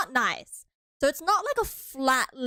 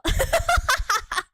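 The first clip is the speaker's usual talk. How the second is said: high, loud peal of laughter; big high-pitched laugh; real laughing fit; 12 semitones higher, 6 dB louder than usual. Real, not acted. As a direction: crack up loudly at your own joke. Laughs at own America joke.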